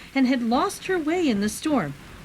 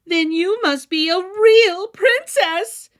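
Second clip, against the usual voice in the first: much higher, louder and slow, triumphant; jubilant shout; 9 semitones higher, 8 dB louder than usual. A narrator's reading. Queen thrilled by the girl's answer, shouting with joy. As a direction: crow it out in delight. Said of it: "the queen jubilantly shouted".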